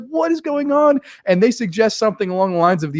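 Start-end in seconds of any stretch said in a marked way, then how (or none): 0.1-1.0 acted bewilderment. Acted nervous reaction to scene.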